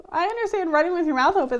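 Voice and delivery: ordinary talk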